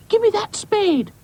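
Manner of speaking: very high bratty demand; shouting boy. Acted. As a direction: shriek for it like a squabbling child. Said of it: "shouted Boz".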